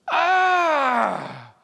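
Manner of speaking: high, loud cry of rage; angry scream. Acted. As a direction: let out an angry yell. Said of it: "He raged with anger".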